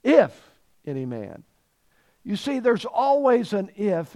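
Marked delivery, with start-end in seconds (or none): 0.0-1.4 "if" isolated and punched, then low "any man"; stress on if. Shown as a demonstration. Preacher repeats the verse to his congregation, stressing "if". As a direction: strike "if", pause, let "any man" fall. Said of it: "I'll emphasize a different word".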